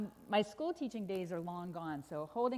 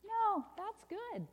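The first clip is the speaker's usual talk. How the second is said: high, slow, swooping reassurance; cheery correction; 12 semitones higher, much slower than usual. Acted. Storyteller overturns bad news, high and lilting. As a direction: sing out the good news slowly.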